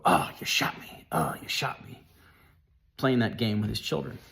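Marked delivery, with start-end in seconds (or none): 0.0-2.0 lower, whispery playful death gasp; dad's pretend dying voice, breathy and low; mock dying. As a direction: ham up being shot for the kids. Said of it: "my friend's dad would go".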